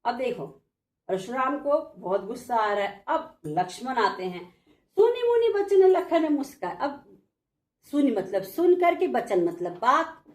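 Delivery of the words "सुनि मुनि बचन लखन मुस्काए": verse recitation. Shown as a demonstration.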